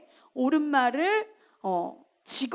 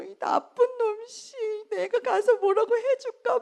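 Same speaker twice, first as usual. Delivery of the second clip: mock teary sympathy; 7 semitones higher than usual. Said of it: "막 이러면서 이제 막 위로를 했어요".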